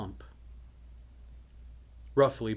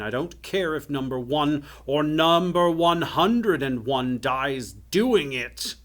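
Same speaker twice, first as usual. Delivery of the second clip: rising, loud tyrant's rant; callous angry outburst; 4 semitones higher, 8 dB louder, slower than usual. A narrator's reading. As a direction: build to a drawn-out shout on the last words. Enchanter furiously orders the dangerous job.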